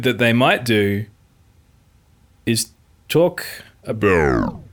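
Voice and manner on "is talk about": powering down. Pretend. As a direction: voice sinks and dies away. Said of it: "He's just powering down".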